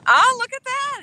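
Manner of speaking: high, loud excited exclaim; delighted, thrilled. Real, not acted. Excited at moderator.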